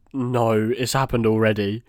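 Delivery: ordinary talk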